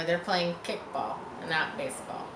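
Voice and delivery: plain reading voice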